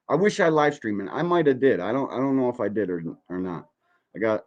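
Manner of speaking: ordinary delivery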